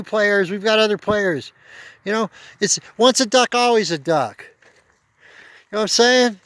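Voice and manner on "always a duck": ordinary delivery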